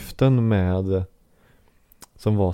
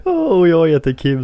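a hint of the mascot's greeting, higher and louder; mimicking Lars; 8 semitones higher, 6 dB louder than usual. Friend joining the joke greeting like the mascot, mock cheerful. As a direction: play along with the hello. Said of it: "sa jag".

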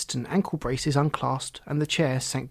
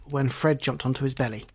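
usual speaking voice

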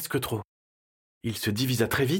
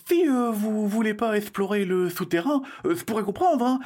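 put-on high, hesitant child's voice; nervous child; 8 semitones higher than usual. Frightened boy offering friends a way out, nervous and high. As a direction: stall timidly in a high little voice. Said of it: "Arthur trembla".

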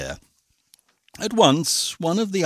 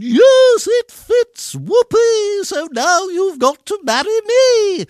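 shrieking, very high ugly-sister voice; high-pitched triumphant squeal; shouted; 10 dB louder than usual. A narrator's reading. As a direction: shout it in gleeful triumph, sky-high and loud. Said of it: "But she shouted".

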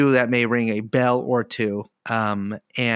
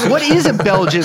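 mock outrage; 7 semitones higher, 6 dB louder than usual. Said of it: "These are all good questions".

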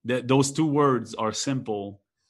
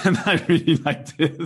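laughing; 5 semitones higher, 5 dB louder than usual. Real, not acted. Laughs while praising co-host.

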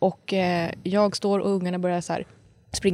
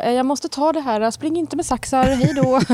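retold call to the kids; 7 semitones higher, slower than usual. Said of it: "Jag bara".

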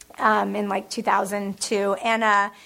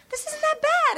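mock surprise. Retold reaction to old script.

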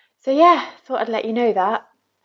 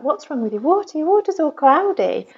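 mock old nan; 8 semitones higher, 4 dB louder than usual. Nan puzzled by cloudy kettle water.

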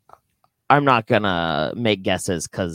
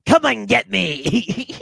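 loud, high, drawn-out dare; playfully taunting, high and loud; mock defiant taunt; 9 semitones higher, 6 dB louder, much slower than usual. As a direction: holler it slowly as a joking challenge. Taunting the Secret Service.

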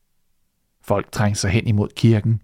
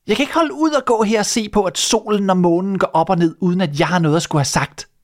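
high, fast, furious shout; the wife yells at her husband in a rush; 9 semitones higher, faster than usual. Acted. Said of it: "råbte hun". (kone der råber hurtigt og rasende)

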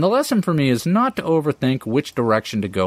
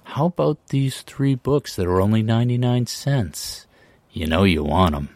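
whispering drug dealer; 4 semitones lower than usual. Pretend. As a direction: murmur it low like a street-corner pusher. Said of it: "whispering sweet offers from the shadows".

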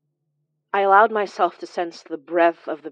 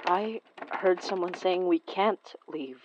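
ordinary talk, nothing marked

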